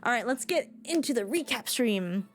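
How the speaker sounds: ordinary talk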